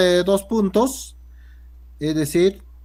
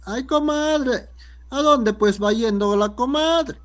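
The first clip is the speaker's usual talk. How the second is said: put-on Cajamarca accent; 8 semitones higher than usual. Teacher imitating a Cajamarca woman calling her comadre. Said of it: "El cajamarquino más o menos dice".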